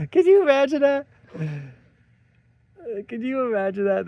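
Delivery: high pitched, laughing through the words; can't stop laughing at how absurd it is; giggling in real disbelief. Real, not acted. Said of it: "That's the most hilarious thing".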